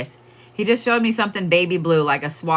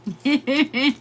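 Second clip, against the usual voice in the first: high giggle; 8 semitones higher than usual. Real, not acted. Laughing after repeated really.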